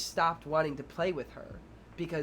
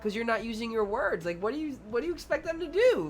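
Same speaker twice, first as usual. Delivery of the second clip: teacher reasoning; 6 semitones higher than usual. Retold or imitated. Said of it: "I tell the girl".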